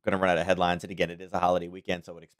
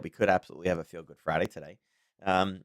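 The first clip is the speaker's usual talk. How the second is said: ordinary talk, nothing marked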